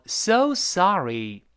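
"so sorry" slow, both "so" and "sa" lifted high. Shown as a demonstration. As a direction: pull "so" up, keep "sa" high, then fall. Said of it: "so声音往上拔，sorry那个sa也".

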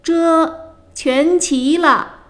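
high, very drawn-out answering call; servant answering from afar. A narrator's reading. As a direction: sing out the reply across a yard. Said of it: "小童在园内回答".

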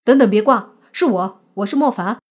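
loud urgent plea; hurried. Acted. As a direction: rush in before she hangs up. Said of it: "莫凡急忙抢先说".

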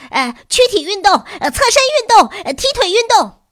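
very high, loud yelps one after another; shrill shouting. A narrator's reading. Pupils piling exercise demands on teacher, shrill shouts. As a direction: squeal out each demand. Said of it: "微博尖着嗓子".

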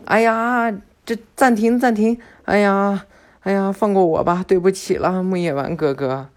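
put-on high, loud whiny pleading kid; begging mercy. Boruto caught by Konohamaru, loud high whining plea. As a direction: plead to be let go.

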